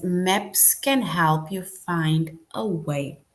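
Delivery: slow, clear model sentence; teacher reading a sample sentence slowly; slow reading aloud. Shown as a demonstration. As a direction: read it slowly for the class. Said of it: "So if we look at that sentence".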